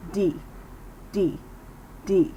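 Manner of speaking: "D" with hardly any air moving; soft D sound. Shown as a demonstration. Teacher contrasts D with T, barely any air. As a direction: say "D" without a puff of air. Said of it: "with the D sound by not moving as much air".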